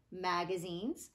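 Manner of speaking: plain reading voice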